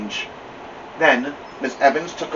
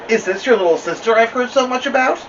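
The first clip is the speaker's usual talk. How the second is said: bright, higher and louder librarian greeting; friendly librarian voice; 11 semitones higher, 3 dB louder than usual. Acted. Kind librarian greeting a girl at her desk, bright and raised.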